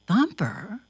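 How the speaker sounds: ordinary delivery, nothing marked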